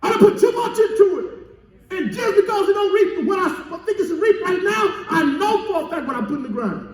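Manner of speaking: impassioned farmer's protest. Acted. Preacher voicing a farmer sure of what he planted, impassioned protest. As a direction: insist from a high pitch, then come down.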